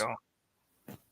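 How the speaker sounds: tinny mic, no low end; thin voice no bass. Real, not acted. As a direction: sounds like inside a tin can. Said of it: "You sound like you're in a tin can again".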